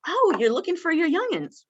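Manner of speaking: high, eager echo of her words; high, interested echo to a confused resident; rephrasing her words. Shown as a demonstration. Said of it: "I'd go up to her and I'd say".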